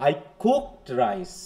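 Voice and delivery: COOKED stressed, drawn out slowly. Shown as a demonstration. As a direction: put the weight on "cooked". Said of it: "হ্যাঁ, কুকের উপর".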